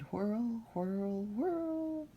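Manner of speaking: rising sing-song whirls; spinning whirl. Acted. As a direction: let each whirl climb. Spinning wheel sound words.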